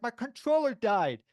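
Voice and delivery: innocent excuse. Retold or imitated. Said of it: "I'm like".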